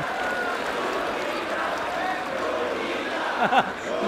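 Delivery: real, drawn-out laughing fit; long amused laugh. Real, not acted. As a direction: crack up at the punchline. Laughing at the Luneta punchline. (mahabang tawa)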